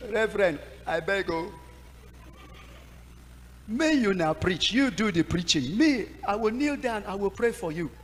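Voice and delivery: high, begging, loud at the end; pleading refusal. Retold or imitated. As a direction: plead not to face the church. Begging pastor, afraid to preach.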